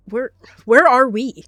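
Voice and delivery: loud, slow, frightened cry; panicked. A narrator's reading. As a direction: voice rising in alarm. Confused cat panicking.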